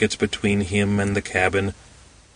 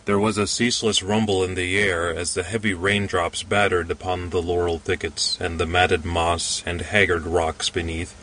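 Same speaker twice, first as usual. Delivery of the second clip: plain reading voice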